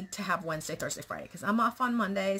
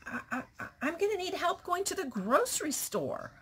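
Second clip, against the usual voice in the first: much higher, fast, half laughing whine; retelling her fretful reply to her husband, higher and quicker with a laugh; mock whiny self; 12 semitones higher than usual. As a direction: ham up your own panicked complaint. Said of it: "I was like".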